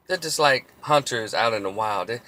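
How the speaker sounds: ordinary talk, nothing marked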